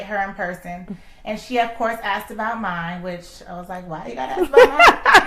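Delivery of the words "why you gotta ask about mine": a loud laughing comeback, pitched up; laughing retort. Retold or imitated. To a friend asking about her love life, laughing loudly. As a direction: toss the question back through laughter.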